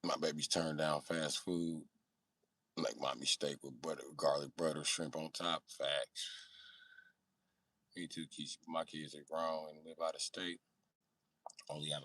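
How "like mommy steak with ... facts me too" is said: normal talking voice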